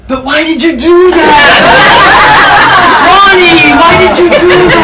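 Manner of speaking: very high, loud, whiny wife voice; shrill complaining mock wife; mock wife whine. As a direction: wail it like a hurt wife in a sitcom. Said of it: "And she'll go".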